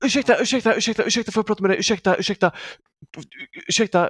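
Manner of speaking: high, urgent mock plea, repeated over and over; imitated distress call. Young fundraiser stopping a shopper at Ica Maxi, imitated distress call. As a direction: plead for attention like a mock SOS. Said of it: "någon sorts rö-, nödrop när han stoppade mig".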